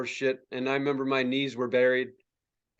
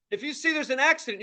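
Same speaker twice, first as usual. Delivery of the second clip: ordinary talk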